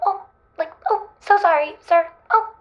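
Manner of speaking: high, fluttery apologetic secretary voice; meek little secretary. Retold or imitated. Paris mimics her old timid secretary self, meek and apologetic. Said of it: "like a little secretary".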